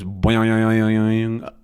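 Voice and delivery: put-on long wobbling "boyoyoyoing"; twanging string noise. Shown as a demonstration. Teacher explaining what a twang is, imitated twanging boing. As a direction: imitate a plucked string, drawn out and bouncing. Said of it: "a sound that kind of goes".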